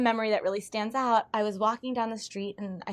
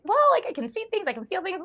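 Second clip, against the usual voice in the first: 1 bright explanation; 11 semitones higher, 5 dB louder than usual. Retold or imitated. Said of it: "And I'm like".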